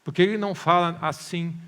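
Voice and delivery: loud mock complaint; impatient demand. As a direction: challenge it like a frustrated believer. Voices the listener's demand again.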